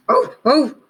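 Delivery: dog calling to squirrel; dog bark. Acted. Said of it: "said Dog".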